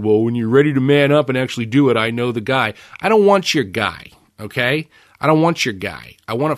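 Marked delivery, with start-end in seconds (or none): 0.0-2.7 lightly voiced friend, louder and quick; a hint of a pushy buddy; friend's advice. Friend Zach urging him to buy, mock pushy. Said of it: "has always said".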